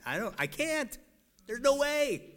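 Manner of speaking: imitated high-pitched objection; helpless protest. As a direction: voice jumping high in mock despair. Voicing people protesting to God.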